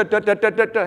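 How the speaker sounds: high staccato "duh duh" rifle shots; playful imitated volley; mock gunfire. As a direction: rattle it off like muskets firing. Soldiers firing in a line.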